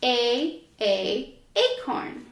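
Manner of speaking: ordinary delivery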